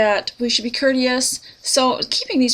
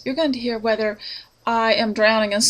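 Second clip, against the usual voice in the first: ordinary delivery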